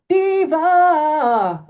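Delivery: high, loud diva call that falls away; loud mock diva wail trailing off; diva cry. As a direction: belt it high then let it drop off. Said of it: "he trails off".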